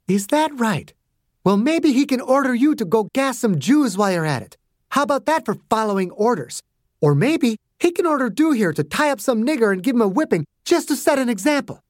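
high, sneering sarcastic voice; mock him cruelly; a hint of sarcasm. A narrator's reading. Margaret mocking Clarence.

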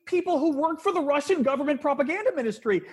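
high-pitched exasperated rant; incredulous indignation. Real, not acted. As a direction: press each point in high disbelief. Indignant rant about hiring propagandists.

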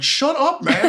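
very high-pitched testy retort; high squabbling bat voice; irritated bat. Acted. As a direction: squawk it in mock annoyance. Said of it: "he's like".

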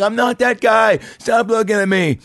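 put-on high, loud drunk scream. Drunk guy screaming at staring clubgoers, high and loud. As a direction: yell at the crowd to stop staring. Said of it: "I'm just screaming and kicking".

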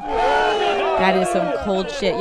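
big, slow, booming reaction; drawn-out loud mock outrage; loud exclamation. Real, not acted. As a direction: belt it out like a shocked announcer.

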